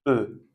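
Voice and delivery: E as a plain rounded "eu"; closed E. Shown as a demonstration. Teacher contrasting the two Es, "E" as "eu". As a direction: say "E" alone as "eu", lips rounded. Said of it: "se prononcent différemment".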